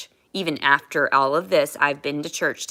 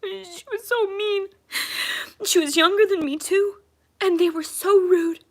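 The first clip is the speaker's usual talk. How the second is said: higher, crying voice throughout; tearful; 10 semitones higher than usual. Real, not acted. Recalling the cruel jail guards, voice high with crying. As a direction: let the tears carry the memory.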